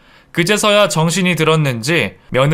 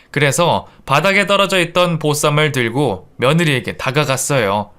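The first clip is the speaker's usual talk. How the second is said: plain reading voice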